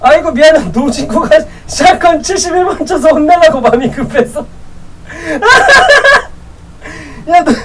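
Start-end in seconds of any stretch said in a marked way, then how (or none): 5.2-6.2 sudden squealing laugh; laughing at the shotgun excuse; high burst of laughter. Real, not acted. Laughing at teammates.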